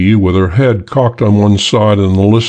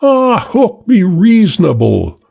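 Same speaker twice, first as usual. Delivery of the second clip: exasperated woman pleading; disgusted; 11 semitones higher than usual. A narrator's reading. Woman pleading with Hook, high and disgusted. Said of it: "The feminine voice, disgustedly".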